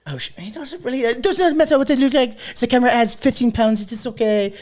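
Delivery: very high, put-on French accent. Fake player explaining his looks, high French accent. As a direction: excuse yourself in a squeaky mock French accent. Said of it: "Can you do a French accent".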